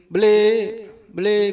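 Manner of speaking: high, loud call of beli; calling out to an empty stall; shouting to the shopkeeper. Retold or imitated. Calling into a shop for the shopkeeper, loud and high. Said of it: "gua teriak".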